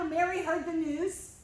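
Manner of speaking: ordinary delivery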